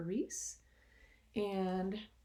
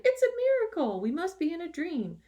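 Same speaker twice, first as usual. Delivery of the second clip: much higher, louder amazed shout; 10 semitones higher, 8 dB louder than usual. Acted. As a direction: cry out in disbelief and wonder. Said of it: "They shouted".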